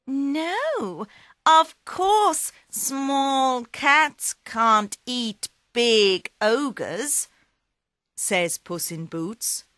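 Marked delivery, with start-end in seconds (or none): 0.1-7.3 higher, slow cat voice; sly cat. Acted. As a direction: agree sweetly, setting a trap. Puss agrees slyly.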